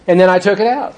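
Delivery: normal talking voice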